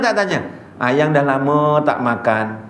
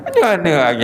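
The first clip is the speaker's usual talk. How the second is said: normal talking voice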